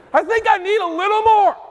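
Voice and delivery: high, strained mock protest; hammed-up exasperation, stretched at the end; exasperated plea. Acted complaint to God's easygoing tone.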